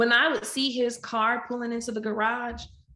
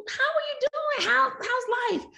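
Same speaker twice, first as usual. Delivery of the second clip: very high, put-on small-talk voice; chirpy well-wisher. Acquaintance asking a wife about life, chirpy well-wisher. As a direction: imitate a nosy acquaintance in a squeaky sing-song. Mimics people asking after her.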